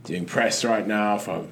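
slow, posh English press-tour drawl. Retold or imitated. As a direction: play Bale plugging his film, slowly. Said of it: "That's how he talks in interviews".

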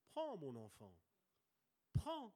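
soft, low reassuring father; tender fatherly reply. Pretend. God the father answers his child, soft and tender. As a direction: give it gently like a loving father.

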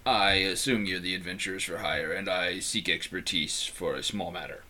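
low, soft, flat priest voice; deep monotone voice. A narrator's reading. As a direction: drop it low and drone. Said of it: "I'll do that better".

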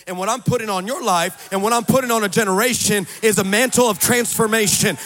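loud, high, shouted sermon build; fiery preaching. Acted. Preacher rallying the congregation, fiery shouting. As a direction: preach it over the crowd at full force.

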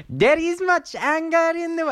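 put-on high-pitched, not-quite-French accent; squeaky mock Euro DJ voice; [name]. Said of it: "what a weird fucking voice [name] has".

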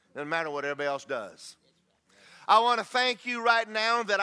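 rising, fervent thanksgiving prayer. Shown as a demonstration. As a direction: pray it out loud with full conviction. Demonstrates his morning prayer aloud.

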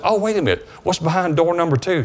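high, bright teasing ask; playful high-pitched curiosity; playful eager question. Retold or imitated. Teasing after the healing.

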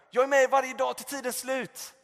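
loud, high call fading a little; God calling out. Retold or imitated. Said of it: "höra Guds röst bara ropa".

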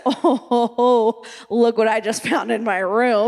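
low, lightly voiced child absorbed in a find; lightly acted distraction. Distracted kid finding something in her room, lightly acted wonder. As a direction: drop low, as if sidetracked by a discovery.

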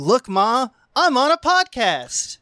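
put-on high, slow proud kid voice; excited kid. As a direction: call out proudly like a child. Child excited impression.

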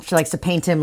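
ordinary talk, nothing marked

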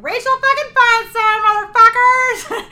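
high, loud, slow shouting of a name; yelled. Retold or imitated. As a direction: yell it across the club. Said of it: "she just yelled in the middle of the set".

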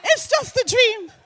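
high, loud relieved exclaiming with laughter; relieved shock. Retold or imitated. Mother waking from the dream, high relieved exclaiming. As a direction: gasp out relief that it was only a dream. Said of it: "I'm like".

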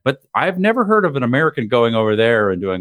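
usual speaking voice

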